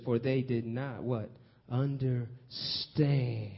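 Understand stretched very slow and long as a call-and-response; drawn-out emphasis. Shown as a demonstration. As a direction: pull out the word Understand slowly for the congregation. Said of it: "But you look closely, it says".

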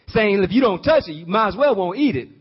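ordinary talk, nothing marked

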